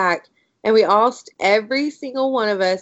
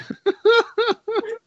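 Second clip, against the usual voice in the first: laughing; 13 semitones higher than usual. Real, not acted. Laughing at the panic.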